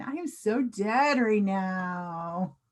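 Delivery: slow, easygoing drawn-out delivery; relaxed slang. Shown as a demonstration. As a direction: stretch it out casually, nothing robotic. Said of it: "say it in kind of a relaxed way".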